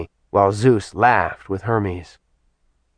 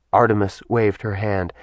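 usual speaking voice

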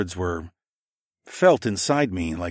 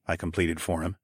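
ordinary delivery

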